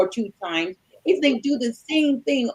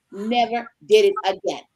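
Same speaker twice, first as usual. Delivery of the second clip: normal talking voice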